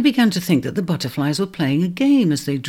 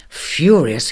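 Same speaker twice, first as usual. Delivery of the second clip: plain reading voice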